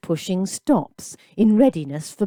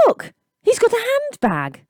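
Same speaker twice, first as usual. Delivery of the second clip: high, sing-song mocking call; woman mocking the music case; mocking jeer. A narrator's reading. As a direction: point and mock.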